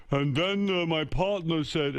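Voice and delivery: high, slow ranting dad; dad impression. Father at the dinner table griping about his partners, high and drawn-out. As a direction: imitate Dad grumbling through his workday story. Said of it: "and he'd go".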